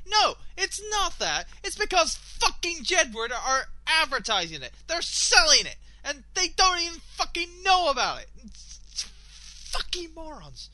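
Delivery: loud, high angry ranting; rage rant. Real, not acted. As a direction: spit it out furious at the adverts. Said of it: "I'm totally on a rage rant right now".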